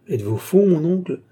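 high-pitched, incredulous exclamation; acted outburst of shocked disbelief; astonished cry. A narrator's reading. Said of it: "s'écria l'Aiguillon".

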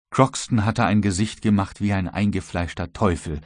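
ordinary delivery

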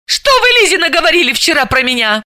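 high, fast, irritated demand; раздражённо; irritated question. A narrator's reading. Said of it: "раздражённо спросила она".

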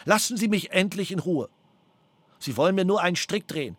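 ordinary delivery

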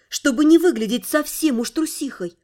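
usual speaking voice

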